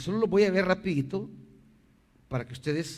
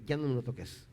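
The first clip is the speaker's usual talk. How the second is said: ordinary delivery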